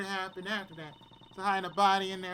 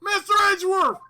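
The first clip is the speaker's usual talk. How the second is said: loud, high call; excited loud call; 10 semitones higher, 11 dB louder, faster than usual. Acted. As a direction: call his name across the room. Calling out.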